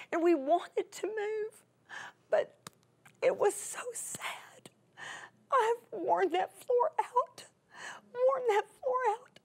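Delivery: high, slow and half-whispered through real crying; words breaking as she cries over the empty house; real tears. Real, not acted. Crying while leaving the family home. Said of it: "laminated in my tears".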